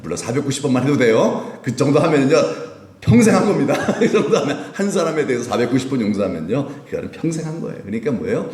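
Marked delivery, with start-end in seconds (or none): 3.0-3.7 higher and louder, pressing the point; emphatic exclaim. Real, not acted. Preacher to congregation, emphatic exclaim. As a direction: drive it home to the congregation.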